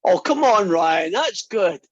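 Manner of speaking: mock-outraged comic complaining to a silent class; exasperated protest. Real, not acted. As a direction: come on, that joke was good. Class not laughing at joke.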